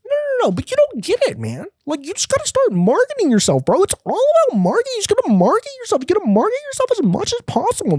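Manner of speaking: very high, fast squeaky pitch of a hustle guru; Gary Vee. Retold or imitated. Gary Vee insisting the homeless guy market himself, very high and fast. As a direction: keep pushing it, squeaky and relentless. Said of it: "He goes".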